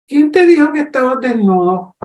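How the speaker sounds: a hint of God as a patient questioner; God's questioning voice. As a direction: coax a confession out of him.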